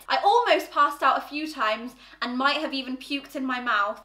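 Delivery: normal talking voice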